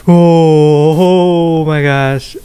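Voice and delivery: long drawn-out voiced "oh"; stunned exclamation. Real, not acted. Gamer reacting to a shock, stunned exclamation. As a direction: let a big "oh" sink down into disbelief. Same words after whispered take.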